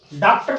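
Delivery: डॉक्टर said as "daaktar", with a pure open aa; wrong "daa" vowel. Shown as a demonstration. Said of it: "ऐसा उच्चारण करेंगे तो ये भी गलत है".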